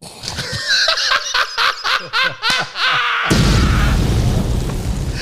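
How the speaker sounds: a high, helpless shriek of laughter. Real, not acted. Laughing at the calm friend's line, a high shriek. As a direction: lose it completely, squealing with laughter. Howling at his own story.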